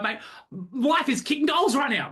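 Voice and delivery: high, pumped-up bragging; top energy. Acted. Coach topping a caller's "phenomenal", top energy. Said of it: "each word has a different energetic signature".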